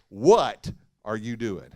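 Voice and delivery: "What" hit hard and slow, the rest falling away; stress on WHAT. Shown as a demonstration. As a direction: lean hard on "What", drawn out. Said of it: "we could preach that four different ways".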